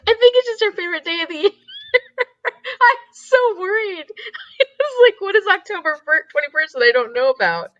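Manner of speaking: words pushed through high laughter; laughing while talking. Real, not acted. Streamer laughing at a viewer's birthday comment. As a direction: talk through helpless laughter.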